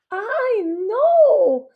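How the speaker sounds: high, loud dismayed cry; a mother's shocked wail of dismay; loud, high-pitched "Ay, no!" in dismay; Mommy exclaims. A narrator's reading. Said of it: "Mommy exclaims".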